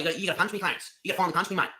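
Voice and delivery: usual speaking voice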